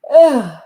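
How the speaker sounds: loud yawn sound. Real, not acted. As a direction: let out a big yawn. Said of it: "sorry, had a yawn".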